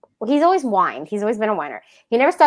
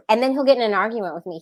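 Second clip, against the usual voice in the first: usual speaking voice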